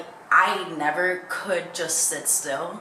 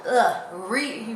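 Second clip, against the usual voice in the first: normal talking voice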